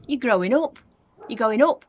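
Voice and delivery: loud, high alarmed shouting; shouted. A narrator's reading. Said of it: "George gave a jump and shouted".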